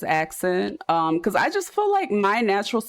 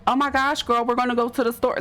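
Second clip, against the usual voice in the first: put-on higher, quick South Jersey girl chatter; modern South Jersey accent; 6 semitones higher, faster than usual. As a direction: play up your own South Jersey accent, pitched up. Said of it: "'cause what would I be like?".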